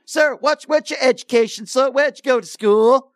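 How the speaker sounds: mocking caller's lingo. Host mocking a caller before dropping him, sneering. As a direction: bait him, then kick him off. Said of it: "after I started to speak his language".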